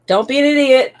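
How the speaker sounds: loud, high exasperated yell; exasperated shout. Real, not acted. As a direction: blurt it out in frustration. Player's outburst at character.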